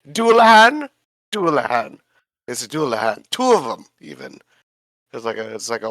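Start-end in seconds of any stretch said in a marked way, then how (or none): 0.1-0.9 high, rising, loud guess at the name; unsure question. Real, not acted. Player asking the GM the monster's name, a high questioning guess. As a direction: toss the word up as an unsure question, Dualahan with a high rising end.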